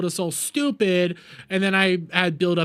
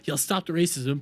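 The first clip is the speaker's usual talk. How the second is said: ordinary talk, nothing marked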